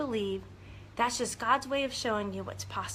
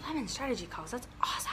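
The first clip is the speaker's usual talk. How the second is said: soft, breath-only awe; hushed amazement; 9 dB quieter, faster than usual. Real, not acted. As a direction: drop to a whisper in wonder. Marvelling at news after cheering.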